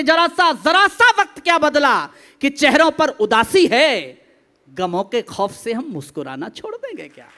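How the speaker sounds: very loud declamation that drops on the final line; dramatic stage poet; rousing poetry recitation. Acted. Recites verse, drops at end.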